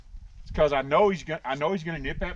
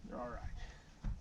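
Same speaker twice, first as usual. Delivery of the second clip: soothing the horse; 15 dB quieter than usual. Real, not acted.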